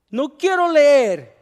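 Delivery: high, loud, slow whiny refusal; boy refusing his teacher, whining; child's refusal. Acted. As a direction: dig in like a stubborn kid.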